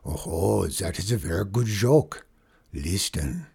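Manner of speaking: put-on light French accent, amused; a hint of a French trapper telling a joke. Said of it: "a French trapper".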